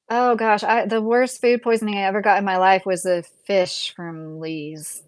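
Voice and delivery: normal talking voice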